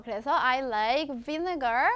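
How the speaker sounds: usual speaking voice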